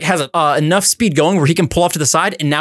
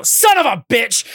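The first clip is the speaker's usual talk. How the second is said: drawn-out, raised-pitch mock curse; higher, slower retold outburst; exasperated outburst; 5 semitones higher, slower than usual. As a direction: stretch it out in comic exasperation. Exasperated outburst on seeing sign.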